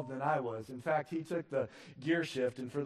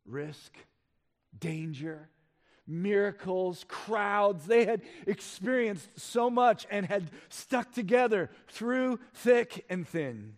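louder and higher, slowing on the last words; rising preaching climax; 5 semitones higher, 5 dB louder, slower than usual. Acted. Preacher recalls the disciples' years, building loud. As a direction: build the list to a peak.

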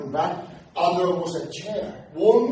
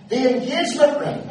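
loud, high proclamation of engagement ring; announced loudly; 10 semitones higher, 8 dB louder than usual. Retold or imitated. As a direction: broadcast it to the whole shop. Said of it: "but he didn't whisper".

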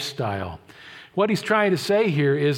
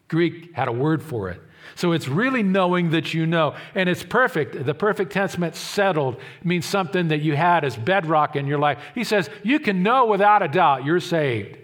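ordinary delivery

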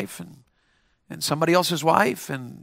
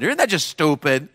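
ordinary talk, nothing marked

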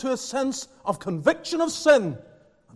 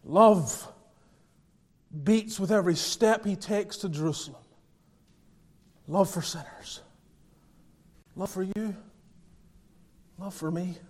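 tender hush; 3 semitones lower, 4 dB quieter than usual. Acted. Tender reflection on love.

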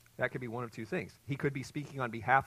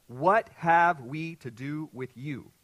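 slow, louder shout of the demon; mock demon cry; 3 semitones higher, 10 dB louder, much slower than usual. Preacher acting the demon's slow cry. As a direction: drag it out like a demon crying out. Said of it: "What does he say?".

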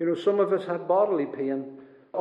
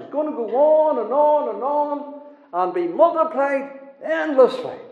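louder sermon delivery climbing high; forceful preaching; 6 semitones higher, 6 dB louder than usual. Real, not acted. Preacher warns that sorrow will be multiplied, forceful rising preaching. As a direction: build in pitch as you pile it up.

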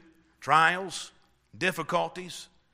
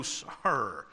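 normal talking voice

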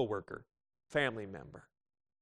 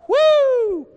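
high, loud whoop cut short; one big woo before the bad news; mock whoop; 15 dB louder than usual. Churchgoer whooping before the boss's call. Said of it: "you're just like".

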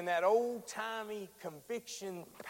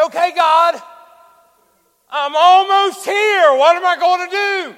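loud, high, pleading call; anxious cry to God; 9 semitones higher, 20 dB louder than usual. Retold or imitated. Panicked prayer while driving.

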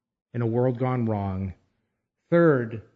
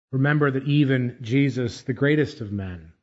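normal talking voice

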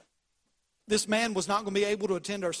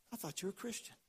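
very soft, hushed quote; quiet rebuke; 13 dB quieter, faster than usual. Retold or imitated. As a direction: let the reproach land almost under the breath. Preacher repeats the coworker's rebuke.

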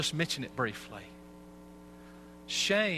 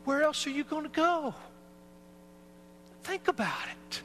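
sharply higher, urging question; pleading high question; 12 semitones higher than usual. Real, not acted. Preacher urging the congregation, high and urgent.

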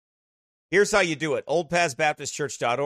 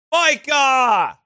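loud call across the room; 11 semitones higher, 9 dB louder than usual. Real, not acted. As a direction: shout a name to someone far off. Calls out to someone off-mic.

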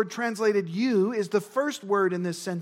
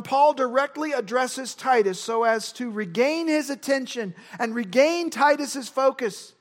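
ordinary talk, nothing marked

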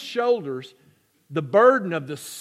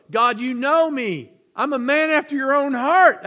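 David's plea; 5 semitones higher, 5 dB louder than usual. Retold or imitated. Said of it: "That's David, right?".

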